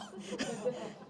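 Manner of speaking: short, soft laugh. Real, not acted. Teacher amused by her own acted denial, soft laugh. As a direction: laugh lightly after the outburst.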